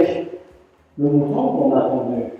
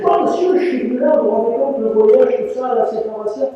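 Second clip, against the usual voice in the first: put-on pleading young self; 6 semitones higher than usual. Young [name] pleading with master.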